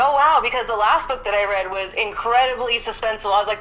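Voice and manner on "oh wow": bright, amazed "oh wow"; impressed wow. Retold or imitated. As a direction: sound genuinely impressed. Retells her own impressed reaction.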